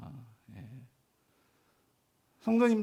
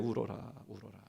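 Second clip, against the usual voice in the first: soft echo; 11 semitones lower, 13 dB quieter than usual. Acted. Echoing the command softly.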